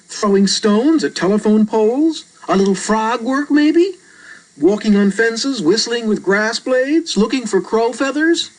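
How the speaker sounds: put-on a matter-of-fact young boy; a hint of a casual boy explaining; boy character voice. Reader voices Albert.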